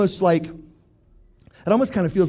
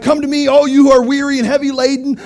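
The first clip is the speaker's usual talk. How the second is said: mock street preacher; 6 semitones higher, 5 dB louder than usual. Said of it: "getting up on your lunchroom table and being like".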